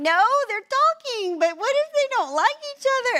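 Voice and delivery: very high, loud panicky wail; hammed-up fretting, words stretched out; imitated meltdown. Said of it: "just full-on meltdown".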